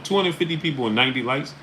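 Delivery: exasperated streamer; incredulous. Real, not acted. Host repeats like count again.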